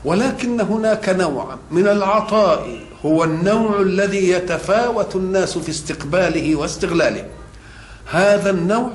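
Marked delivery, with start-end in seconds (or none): none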